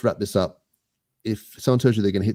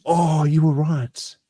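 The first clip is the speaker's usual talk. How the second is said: surprised realization; 9 semitones higher, 3 dB louder, much slower than usual. Real, not acted. Realizing mistake on quiz.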